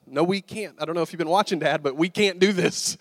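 mock-exasperated kid arguing back; insistent, disbelieving inner objection; exasperated protest. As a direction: push back at Dad in lively protest. Boy's protesting thought back at dad.

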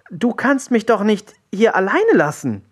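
put-on high-pitched, pleading boy's protest; protesting cry. A narrator's reading. Willi begs the pirate not to leave him, high protest. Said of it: "rief Willi".

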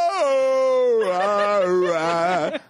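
high mock yelling, fading out; whooping past the old man's cabin; retold yelling. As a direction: imitate a drunk whooping on a four-wheeler. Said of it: "yelling and screaming".

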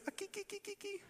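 very high, soft tittering ki-ki laugh; high giggle. Pretend. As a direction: squeak out a tiny high giggle. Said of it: "laugh in different languages".

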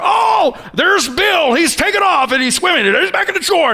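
over-the-top Ironman announcer; hyper announcer. Retold or imitated. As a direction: hype the swimmers like a race caller. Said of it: "this hyper announcer".

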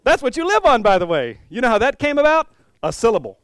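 loud, high show-off; excited boasting. Pretend. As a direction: brag with big energy. Jesus boasting in dialogue.

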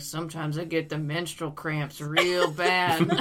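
low-voiced mock tough guy; burly tough guy. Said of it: "this real burly guy, just, like, super tough".

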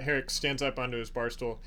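ordinary talk

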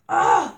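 exasperated loud groan; fed-up loud grunt; loud disgusted ugh. Real, not acted. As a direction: groan in frustration. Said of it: "Didn't mean to rant".

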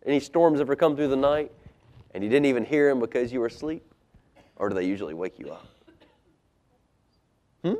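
ordinary delivery

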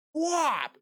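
sharp high whop of a punch; punch sound effect. Retold or imitated. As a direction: smack the sound out. Imitating the brother's punch.

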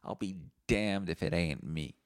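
ordinary talk

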